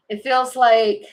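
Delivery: ordinary talk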